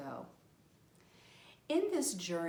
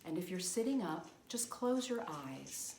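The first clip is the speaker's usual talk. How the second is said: guided meditation. Acted. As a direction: guide them into relaxation, phrase by unhurried phrase. Leads guided visualization over drumming.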